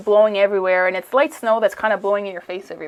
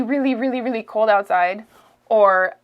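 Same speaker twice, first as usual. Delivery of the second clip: ordinary delivery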